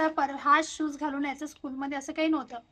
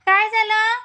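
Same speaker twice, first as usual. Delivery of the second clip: concerned call; 5 semitones higher, 6 dB louder, slower than usual. Real, not acted. Baby crying, mother calls. (रडणाऱ्या बाळाला आईची काळजीची मोठ्याने हाक)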